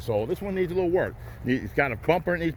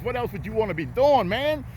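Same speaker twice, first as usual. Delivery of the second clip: much higher, gleeful exclamation; excited joy; 7 semitones higher than usual. Real, not acted. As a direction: gush with delight. Delighted exclamation to viewers.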